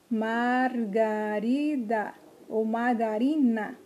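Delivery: "margarida ou margarina" slowly, each syllable held; teacher sounding out "margarida ou margarina" slowly. Shown as a demonstration. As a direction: stretch "margarida" and "margarina" into four beats each. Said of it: "as palavras que possuem quatro".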